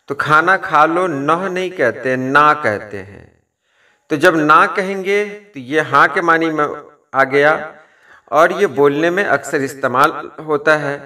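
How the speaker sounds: ordinary delivery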